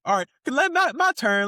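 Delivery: high, eager my-turn call; eager for revenge. Retold or imitated. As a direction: ask for your turn, itching to hit back. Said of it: "I would've got up like".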